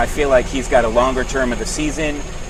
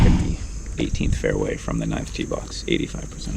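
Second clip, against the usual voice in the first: hushed, lower golf broadcaster whisper; whispering golf commentator; 8 dB quieter than usual. Pretend. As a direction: whisper the odds like a TV golf announcer.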